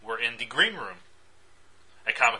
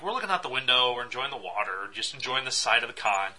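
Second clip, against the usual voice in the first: usual speaking voice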